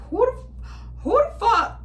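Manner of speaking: mock her own alarm, high; startled outrage. Vlogger reliving finding a bag on her car, high outrage. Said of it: "And I'm like".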